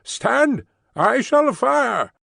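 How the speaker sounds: high guard's shouted warning; high, drawn-out armed challenge; roared challenge. A narrator's reading. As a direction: call out high to halt a stranger. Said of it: "as loud as he could roar".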